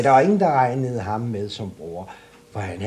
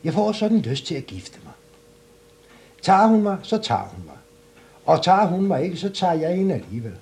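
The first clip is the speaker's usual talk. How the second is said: higher and louder, rushing the end; eager and cocky; 7 semitones higher, 4 dB louder, faster than usual. Acted. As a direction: boast with cheeky determination. Klods Hans demands a horse.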